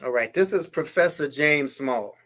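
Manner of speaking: ordinary delivery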